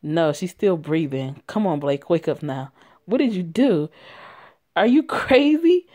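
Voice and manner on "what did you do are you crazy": higher, louder, slowed shout of disbelief; horrified yell. Acted. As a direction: scream at him that he's lost his mind. Horrified friend yells at him.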